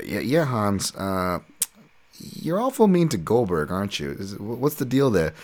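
usual speaking voice

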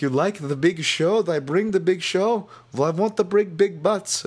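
high, slow, hammed-up French accent. Mimicking the French fighter's post-fight speech in a high French accent. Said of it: "he was in his French accent".

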